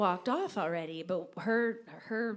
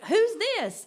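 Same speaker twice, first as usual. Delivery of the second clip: startled who's this; 12 semitones higher, 9 dB louder than usual. Retold or imitated. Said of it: "she was like".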